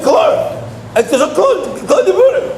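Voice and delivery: high, laughing mock sneer. Jealous bochur mocks the teller's match, high and laughing. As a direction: toss it off like a jealous jibe. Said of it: "The guy told me".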